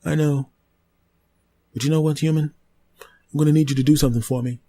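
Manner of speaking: a polite tree warning it may silence you; talking tree, menacing. Acted. Tree character demands.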